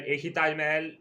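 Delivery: ordinary talk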